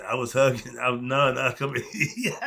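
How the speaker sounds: talking through real laughter; amused, breaking up while speaking; laughing. Real, not acted. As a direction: let the laugh overtake the words. Laughing as he recalls hugging people.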